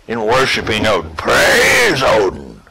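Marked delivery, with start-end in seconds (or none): 1.2-2.6 lower, slow shouted praise; mock battle cry. Teaching players Torvi pride, a slow shouted praise. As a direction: call it out like a proud Torvi.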